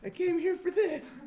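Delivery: high, loud indignant retort; hammed-up outrage, half laughing; mock outraged yell. Retold angry reply, loud high.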